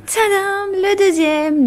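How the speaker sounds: high, bright ta-da; excited reveal. Real, not acted. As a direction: announce it like a surprise. Big reveal of the book.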